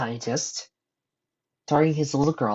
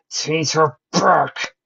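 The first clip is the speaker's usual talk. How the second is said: high, loud, drawn-out demand; raging shout; 6 semitones higher, 8 dB louder, much slower than usual. A narrator's reading. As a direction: roar each word slowly. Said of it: "with rage festering inside him".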